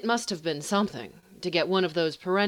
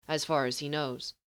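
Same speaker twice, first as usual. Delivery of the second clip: plain reading voice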